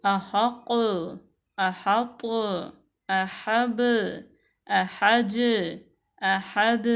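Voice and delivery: अहक and अहब with the final letter bounced, a clear echo on the closing consonant; क़ाफ़ और बा को झटके से वाज़ेह; with qalqala echo. Shown as a demonstration. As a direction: end each word with a small jolt, the last letter popping clearly. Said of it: "जैसे ही वो उसके ऊपर कलकला करेंगे".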